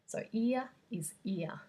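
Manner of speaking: ear said with no R at the end, Australian style. Shown as a demonstration. Teacher showing students the Australian ear, no final R. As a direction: model ear as a plain vowel glide, no final R. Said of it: "the word ear is the same as the phoneme ear in the Australian accent".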